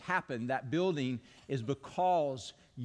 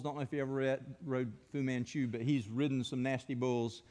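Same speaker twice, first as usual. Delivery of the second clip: ordinary delivery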